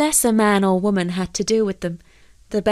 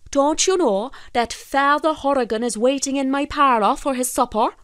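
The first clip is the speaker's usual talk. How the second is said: higher-pitched indignant fisherman; scolding fisherman; 7 semitones higher than usual. Acted. Fisherman tells the fish a priest awaits supper, scolding. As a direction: scold it in a raised-pitch character voice.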